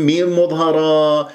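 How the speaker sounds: usual speaking voice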